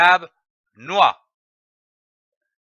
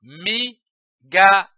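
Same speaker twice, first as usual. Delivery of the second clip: "migale" slowly split into separate syllables; "migale" in detached reading-lesson syllables; slower than usual. Shown as a demonstration. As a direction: sound out "migale" as mi, ga, le. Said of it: "L'association des syllabes donne le mot".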